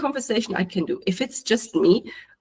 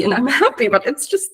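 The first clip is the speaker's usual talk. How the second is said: normal talking voice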